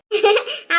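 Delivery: short falling chuckle; brief pleased laugh; amused laugh. Acted. Amused in-law approves the reply.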